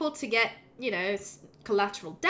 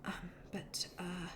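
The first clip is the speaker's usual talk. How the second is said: whisper; 3 semitones lower, 16 dB quieter, slower than usual. Real, not acted. Whispered hesitation.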